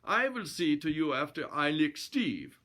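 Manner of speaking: put-on comic Swedish-accented threat; stubborn Swedish challenger; Swedish brute. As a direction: bluster in a mock Swedish accent. Narrator voices threatening Swede.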